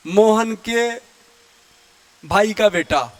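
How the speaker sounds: calling someone by a long five-word name; slow calling out. Shown as a demonstration. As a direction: spell out the whole long name in a slow call. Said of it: "पांच शब्द बोल रहे हो".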